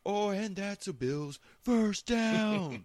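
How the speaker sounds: high, stretched-out mock announcement; mock taunting cheer. Drunk friend taunting a rival fan, goading sing-out. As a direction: needle them with a drawn-out first-down call.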